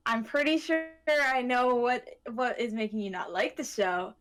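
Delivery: usual speaking voice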